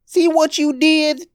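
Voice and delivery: put-on high, slow boy's reproach; tearful child. Boy blames his brother for the broken toy, high tearful. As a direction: accuse him with tears rising. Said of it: "tears filling his eyes".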